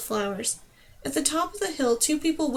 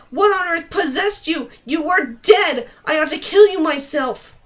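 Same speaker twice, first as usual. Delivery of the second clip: loud, higher angry tirade; furious yelling; 4 semitones higher, 9 dB louder than usual. Acted. Numer scolding Dane after reviving her, furious shouting. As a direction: rage at her, slowing on the threat. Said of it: "he yelled".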